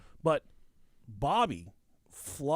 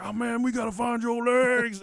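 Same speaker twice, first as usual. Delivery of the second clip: high, loud frantic driver; imitated panicked yelling; 8 semitones higher, 5 dB louder than usual. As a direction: yell as the horrified driver, retold for laughs. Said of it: "they're fucking yelling at each other".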